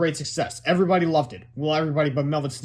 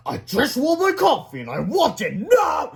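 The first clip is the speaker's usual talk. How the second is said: angry demand; 9 semitones higher, 5 dB louder, slower than usual. Acted. Angry principal demanding coffee.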